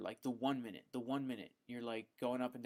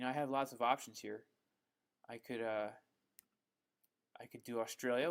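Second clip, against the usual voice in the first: normal talking voice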